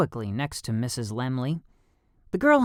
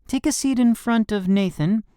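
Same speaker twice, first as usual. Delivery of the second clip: higher, brisk teacher voice; 10 semitones higher, 5 dB louder than usual. A narrator's reading. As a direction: address the class like a teacher. Teacher voice in story.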